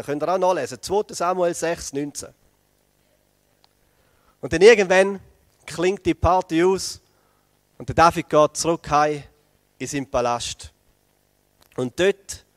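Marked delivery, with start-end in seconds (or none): none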